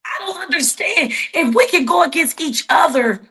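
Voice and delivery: holding back tears as the words pour out; fighting back tears. Real, not acted. Telling the interviewer how baffled she is, near tears and shouting. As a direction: voice climbing high, upset and nearly crying, rising to a shout. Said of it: "and I'm trying not to cry".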